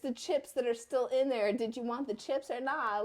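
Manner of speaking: loud, incredulous reply; exasperated. Retold or imitated. Mother answering her wailing son, loud and incredulous. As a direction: push back in disbelief.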